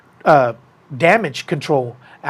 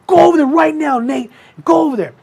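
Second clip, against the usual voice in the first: high, raised-voice command; urgent order; 12 semitones higher, 5 dB louder than usual. Retold or imitated. Scotty ordering Nate to go over there, urgent. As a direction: send him off urgently, pitched well up.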